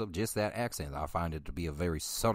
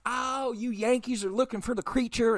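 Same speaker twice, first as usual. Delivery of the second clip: shrill, much higher over-the-top waitress; obnoxious waitress; 6 dB louder than usual. Retold or imitated. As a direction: hollers to the whole diner like a brash waitress. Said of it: "an obnoxious waitress".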